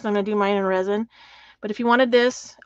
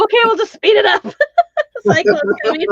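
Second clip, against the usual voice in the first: laughing; 11 semitones higher, 7 dB louder, faster than usual. Real, not acted. Laughing joke about cyclone.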